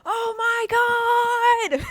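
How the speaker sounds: very high, loud, drawn-out squeal; shocked wail. Real, not acted. Creator called out by the reading, high shocked wail. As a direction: stretch it into a high, stunned wail. Shocked at being described exactly.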